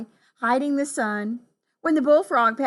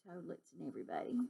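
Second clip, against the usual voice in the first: ordinary delivery, nothing marked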